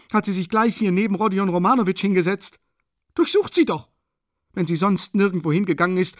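high, urgent arguing that bursts into shrieks; frantic protest. A narrator's reading. Katerina demanding they search Sonja, frantic. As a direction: argue at high pitch, shrieking each demand.

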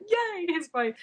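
excited. Retold or imitated. To herself, seeing her class friend going vegan, excited. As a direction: squeak it out, thrilled. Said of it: "I'm quite excited".

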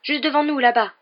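high, loud pointing-out call; excited exclamation. A narrator's reading. Boy directing his friend to the train, exclaiming. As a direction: cry out the sighting eagerly. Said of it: "s'exclama Harry".

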